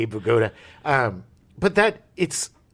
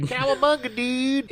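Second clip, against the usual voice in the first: Bart Simpson voice; 11 semitones higher than usual. Retold or imitated. Quoting Bart catchphrase.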